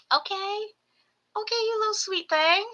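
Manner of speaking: high sweet pet talk. Real, not acted. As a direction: coo at a tiny pet. Cooing at the gecko.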